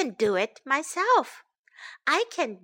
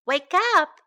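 higher, louder wake-up call; raised, lively call; 4 semitones higher, 5 dB louder than usual. A narrator's reading. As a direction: call out to rouse a sleeper. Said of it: "called Stella".

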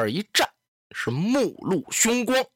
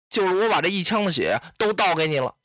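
ordinary delivery, nothing marked

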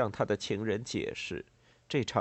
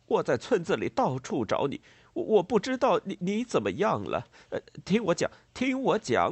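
frantic higher-pitched stammering farmer; 6 semitones higher, 4 dB louder than usual. A narrator's reading. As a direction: blurt it out, tripping over words. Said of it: "他结结巴巴地说道". (慌乱激动，结结巴巴)

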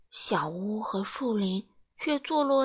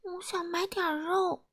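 soft, high, tiny voice; timid small voice; 8 semitones higher, 6 dB quieter than usual. A narrator's reading. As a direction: speak up very quietly and meekly. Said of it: "他小声地说". (又轻又细地小声说)

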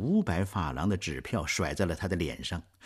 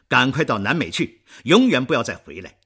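loud, higher banishing order; angry command; 5 semitones higher, 9 dB louder than usual. Acted. Marius banishing Thénardier, loud angry command. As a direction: order him out for good.

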